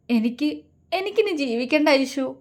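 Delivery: acted tearful voice, lifted in pitch; falter, then whimper in despair; on the verge of crying. A narrator's reading. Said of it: "അവൾ വിതുമ്പി".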